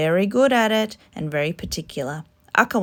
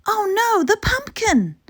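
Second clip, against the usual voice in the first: alarmed; 6 dB louder than usual. Acted. Betty's dismay.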